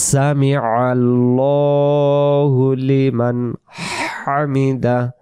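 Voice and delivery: slow, drawn-out সামি আল্লাহু লিমান হামিদা; measured chant-like prayer phrase; model recitation. Shown as a demonstration. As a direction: stretch the "lo" of আল্লাহু and breathe the h in হামিদা. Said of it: "তাহলে উচ্চারণ করব".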